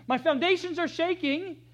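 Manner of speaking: high, loud cry of shaken fear; alarmed cry. Acted. Elder quoting the psalmist crying that his foundations shake, high alarm. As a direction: cry out as the ground trembles.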